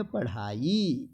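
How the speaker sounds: पढ़ाई slow, with breathed flap; lingering "paṛhaa-yee" for पढ़ाई; correct pronunciation. Shown as a demonstration. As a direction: stretch पढ़ाई to show the ṛh. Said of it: "उक्षिप्त वर्ण का प्रयोग करेंगे".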